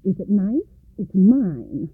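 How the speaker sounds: loud, grabbing whine; a spoilt child laying claim; greedy stepsister. A narrator's reading. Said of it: "cried the other".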